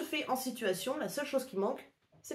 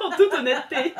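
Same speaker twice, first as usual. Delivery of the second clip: laughing; 5 semitones higher, 8 dB louder than usual. Real, not acted. Laughing loudly.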